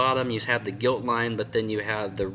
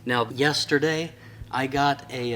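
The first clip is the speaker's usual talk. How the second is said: usual speaking voice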